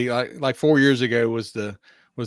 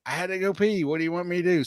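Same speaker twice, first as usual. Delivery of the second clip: put-on quoting drunk passenger; 6 semitones higher, faster than usual. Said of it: "And she's like".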